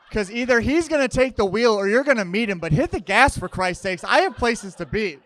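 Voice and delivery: loud, high, fed-up rant; exasperated rant. Real, not acted. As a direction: yell it like a stuck impatient driver. Ranting at cautious Jesus Fish drivers.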